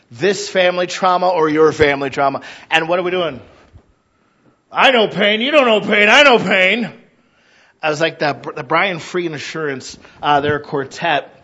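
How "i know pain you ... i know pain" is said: mock one-upping. Mock one-upping over pain.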